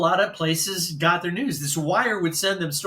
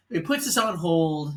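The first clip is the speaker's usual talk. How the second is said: normal talking voice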